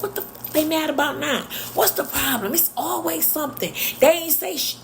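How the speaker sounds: imitating an outraged friend's cussing tirade; indignant rant. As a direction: vent it like a fed-up union man. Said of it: "he was real militant".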